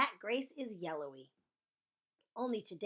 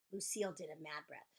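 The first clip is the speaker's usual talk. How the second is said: plain reading voice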